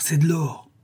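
high excited cry; thrilled exclamation, stress on de. A narrator's reading. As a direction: burst out at the sight of gold. Discovering the gold.

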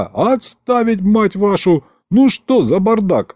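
high, exasperated shouted order; angry yelling. A narrator's reading. Governor scolds the brawling crowd, yelling angrily. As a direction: bawl them out. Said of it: "заорал я".

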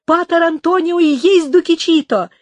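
high, loud, emphatic confirmation; jubilant exclamation. A narrator's reading. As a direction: cry out the good news with full conviction. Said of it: "воскликнул Артуро".

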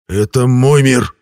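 slow, forceful growl; firm, drawn-out retort. A narrator's reading. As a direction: growl it out, word by word. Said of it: "прорычал я".

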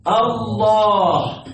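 الله said very slowly, the long lam held; drawn-out Allah. Shown as a demonstration. Teacher showing students how the name forms, said very slowly. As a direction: stretch الله out slowly, every sound held. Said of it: "ها".

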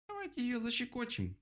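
very high squeaky mouse voice; suggesting mouse. A narrator's reading. Mouse offers the animals a plan, squeaking. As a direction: pipe up with a tiny voice. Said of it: "предложила мышка".

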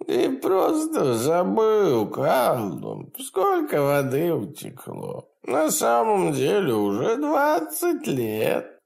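high, gleeful imp voice; cackling hell torturer. Acted. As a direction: taunt him with cruel glee. Demon taunts.

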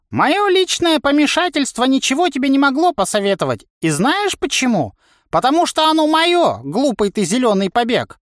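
put-on very high, worked-up old coach; cantankerous old trainer. A narrator's reading. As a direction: scold the youngster in a high, heated voice. (сварливый старый тренер, высоко и запальчиво)

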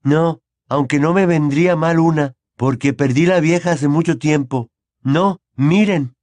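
lightly voiced nervous schoolboy; Neville voice. A narrator's reading.

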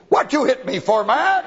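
mock donkey. The donkey protesting to Balaam, hammed-up and high. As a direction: squeal it out like an offended donkey.

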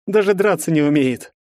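mock the loser to the crowd; contemptuous. A narrator's reading. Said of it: "презрительно кинул мне Герцен". (с презрением)

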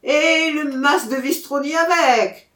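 high, loud repeated shout; crowd echo. A narrator's reading. Crowd repeats the jibe about the farm, high loud call. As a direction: echo the heckle as a whole crowd.

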